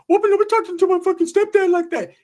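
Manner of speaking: very high, squeaky mock threat; frantic cartoon-high cousin confronting someone; Ricky. Cousin defending his stepdad, squeaky high imitation. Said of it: "Ricky's like".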